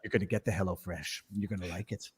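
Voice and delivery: soft, low ASMR murmur. Retold or imitated. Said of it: "A-, A S M R".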